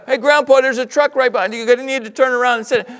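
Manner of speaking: put-on excited kid. Kid calling to grandpa.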